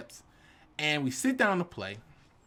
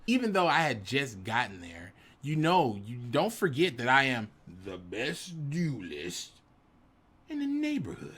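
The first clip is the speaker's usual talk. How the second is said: ordinary delivery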